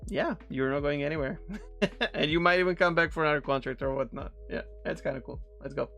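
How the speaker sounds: ordinary delivery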